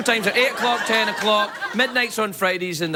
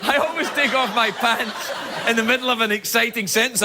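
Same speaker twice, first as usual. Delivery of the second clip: ordinary delivery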